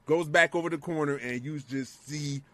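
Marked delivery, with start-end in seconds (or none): none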